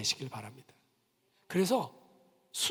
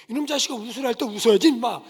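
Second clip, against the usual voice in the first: louder, fast hammed-up yell at a kid; mock scolding shout; 3 semitones higher, 8 dB louder than usual. Photographer yells at a fussing child, mock angry. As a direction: bark it like a fed-up photographer.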